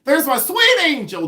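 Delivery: very high, loud screeched greeting; Pamela Blanchard. Retold or imitated. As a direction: screech it way up high, imitating a delighted friend. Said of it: "I think I can do it".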